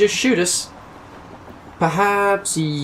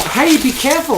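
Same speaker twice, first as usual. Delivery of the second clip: alarmed; 9 semitones higher, 4 dB louder than usual. Acted. Bombs being handled.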